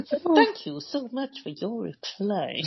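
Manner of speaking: slow, softened mock-polite thanks; passive-aggressive. Worker replying by email through angry tears, mock passive-aggressive. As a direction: draw out the gratitude, laughing at yourself. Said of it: "really amazingly super passive-aggressive".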